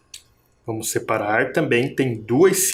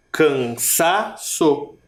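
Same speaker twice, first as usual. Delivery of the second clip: Cansaço in slow syllables, SA opened up; stress on SA; much slower than usual. Shown as a demonstration. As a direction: open out the SA in Cansaço, slowly. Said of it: "mas é o sa". (Cansaço pausado, com o SA mais aberto)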